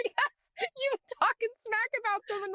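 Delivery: words breaking into a high laugh; giggly, amused retelling. Real, not acted. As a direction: laugh through the line as it tickles you. Laughing while retelling a reel.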